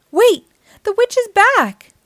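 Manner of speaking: high, loud sudden warning; alarmed. Acted. Warning young listeners the witch returned, alarmed. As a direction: startle the kids with the news.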